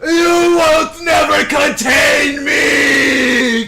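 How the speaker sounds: slow booming roar; shouting. Acted. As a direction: bellow it slowly and huge. Dramatic in-character outburst.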